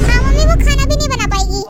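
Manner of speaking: loud phone announcement to mother. Acted. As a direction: call it out to mom so she hears. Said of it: "कह दो।".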